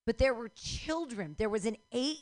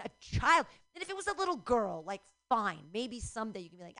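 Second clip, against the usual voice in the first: ordinary delivery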